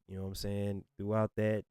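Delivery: ordinary talk, nothing marked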